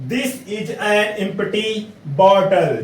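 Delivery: slow, careful English read-out; slow dictation. Shown as a demonstration. Teacher dictating the example sentence slowly to students. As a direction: spell out each word for the class. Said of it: "कह रहा है".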